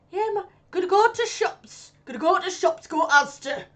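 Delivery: lightly voiced Welsh boyfriend; teasing Welsh voice.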